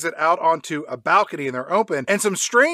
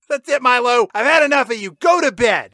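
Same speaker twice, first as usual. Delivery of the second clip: mom scolding; 7 semitones higher, 5 dB louder than usual. Retold or imitated.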